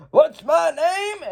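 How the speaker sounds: high, drawn-out villain taunt; hammed-up slow, high call; Garmadon. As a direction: demand it from the crowd, stretched out. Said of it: "And then Garmadon's like".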